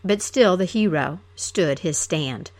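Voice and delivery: plain reading voice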